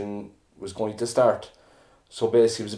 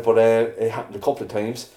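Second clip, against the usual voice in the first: ordinary talk, nothing marked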